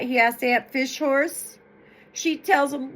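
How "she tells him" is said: plain reading voice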